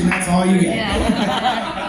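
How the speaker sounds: slow, laughing wind-down, "you" stressed. Retold or imitated. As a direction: drag the punchline out laughing. Retold reply, laughing.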